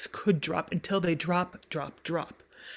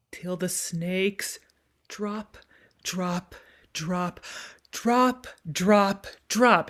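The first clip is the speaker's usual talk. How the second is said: slow, hushed start building to firmer drops; teacher speaks the line as a monologue; spoken as monologue; much slower than usual. Shown as a demonstration. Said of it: "take it as a little monologue section".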